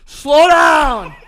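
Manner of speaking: loud, high angry dad yell. Retold or imitated. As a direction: bark it like a furious dad. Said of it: "things your dad has yelled".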